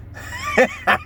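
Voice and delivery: high squeaky falsetto yelp; falsetto squeal. Acted.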